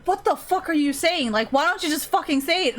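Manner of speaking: exasperated outburst. Retold or imitated. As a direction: throw up your hands at someone who won't make sense. Frustrated non-artists confronting artist.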